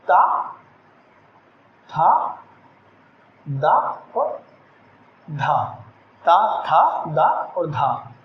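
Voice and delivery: ता, था, दा, धा drawn out, pausing after each; slow consonant demo. Shown as a demonstration. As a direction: dictate ता था दा धा slowly. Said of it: "इसको हम सीधा करके लिख लेते हैं". (धीरे धीरे व्यंजनों का उच्चारण)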